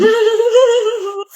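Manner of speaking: high-pitched laugh at a friend; mocking laugh. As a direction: laugh at the other's expense. Laughs at friend's low score.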